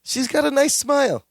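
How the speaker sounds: a hint of a clueless cousin; innocent Balky. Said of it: "Balky comes back up to him and goes".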